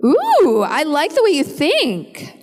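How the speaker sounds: high drawn-out ooh, savoring it; intrigued delight. Real, not acted. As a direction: slowly relish a tempting idea. Relishes the suggested dream role.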